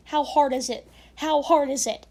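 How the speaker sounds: fed-up shout about grammar; exasperated rant. Real, not acted. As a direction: vent at the reader. Ranting at bad punctuation.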